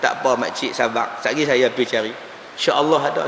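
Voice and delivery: hushed, low, patient tone; calm reassurance. Retold or imitated. Preacher retelling how he asked the auntie to be patient, calm reassurance. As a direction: soothe her softly and keep the pitch down.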